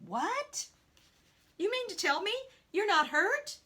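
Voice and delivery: high, incredulous questions; astonished. Acted. Two friends to unhurt man, high-pitched astonishment.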